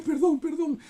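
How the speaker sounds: father pleading with his son for forgiveness; pleading apology. Retold or imitated. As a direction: beg your son's forgiveness, contrite. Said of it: "decía".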